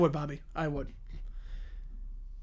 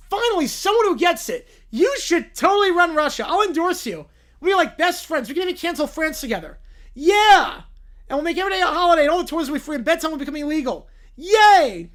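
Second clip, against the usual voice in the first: higher, louder, giddy excitement; giddy child cheer; 6 semitones higher, 7 dB louder than usual. Acted. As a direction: cheer like a kid at a party. The bear cheers the princess.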